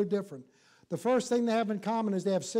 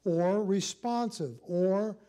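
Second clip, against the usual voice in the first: slow deliberate; slower than usual. Shown as a demonstration. Drawn-out definitions.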